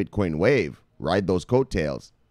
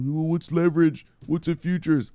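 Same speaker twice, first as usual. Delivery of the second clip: mock clueless viewer; 7 semitones higher than usual. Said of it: "I know".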